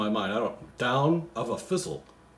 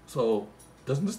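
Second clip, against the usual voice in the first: ordinary delivery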